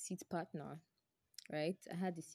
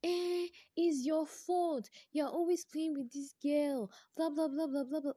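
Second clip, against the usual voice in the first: mocking the jealous friend; 10 semitones higher, 5 dB louder than usual. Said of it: "she was like".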